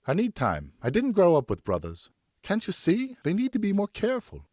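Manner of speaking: a mother begging for care; pleading wife. Acted.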